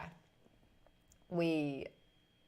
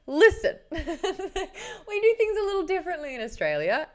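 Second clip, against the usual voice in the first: high, loud opening breaking into laughter; laughing mock retort; 11 semitones higher, 6 dB louder than usual. Newcomer explaining Australian ways to co-host, laughing retort. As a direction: protest through giggles.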